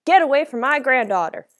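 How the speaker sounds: fierce protective grandfather's shout; commanding shout. A narrator's reading. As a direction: order the attacker off, loudly. Grandfather confronts attacker with rifle.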